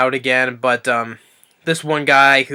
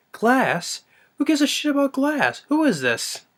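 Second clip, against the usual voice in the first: much higher incredulous rant; mock exasperated outburst; 13 semitones higher than usual. McClane scoffing at the chief on the radio, high exasperated. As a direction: fling it back in disbelief. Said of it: "And it's like".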